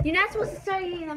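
scolding. Real, not acted. Scolding friend for eating early.

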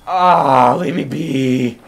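loud, drawn-out protest; frustrated yell. Real, not acted. Gamer attacked near the bonfire, frustrated yell. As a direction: yell to be left in peace. Attacked just after reaching safety.

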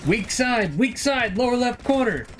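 very high, squeaky mock call-out; high-pitched, hammed-up tactical alarm; mimicking frantic players. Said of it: "They're like".

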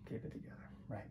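soft, voiced self-reassurance; soft murmured self-talk; softly voiced.

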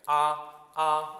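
"아" held high, loud and level; long first tone. Shown as a demonstration. Teacher insisting on length, high level tone. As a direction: keep "아" long, never dipping. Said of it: "빨리 해도 길게 해야 돼요".

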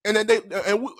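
ordinary talk, nothing marked

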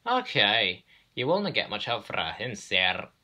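put-on lively Scottish accent, loud start; Scottish twins. Twin engines answer the railway boss, Scottish accent. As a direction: answer back with a Scottish burr.

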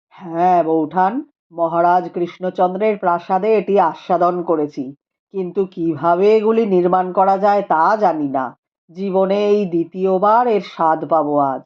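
a calm scholar speaking gravely; Rupendra's voice. A narrator's reading. (নিচু গম্ভীর গলায় পণ্ডিত রূপেন্দ্র)

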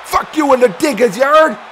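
high, fired-up trash talk; taunting challenge. Acted. Commentator daring a rival to come over, taunting. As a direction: goad a rival into a fight.

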